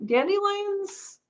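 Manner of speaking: sing-song dandelions, high and slow; high drawn-out word. Pretend. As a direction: stretch it high and playful.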